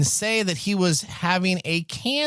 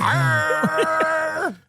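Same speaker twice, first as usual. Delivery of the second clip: high howling dog cry; basset hound; 14 semitones higher than usual. Pretend. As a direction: let out a long hound dog howl. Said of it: "you sound like a freaking hound dog laying on the porch".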